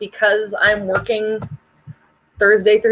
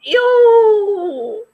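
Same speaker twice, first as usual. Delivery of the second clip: high, louder grossed-out squeal; disgusted ew; 11 semitones higher, 4 dB louder than usual. Real, not acted. Friend hears the chips go to Jamie, a high disgusted squeal. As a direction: recoil in disgust out loud.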